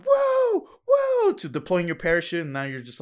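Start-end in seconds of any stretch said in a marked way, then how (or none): 0.1-1.3 high, loud whoops of panic-thrill; mock freefall yell. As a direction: cry out high like you're plummeting. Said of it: "then it's, it's just so calm. You go from, like".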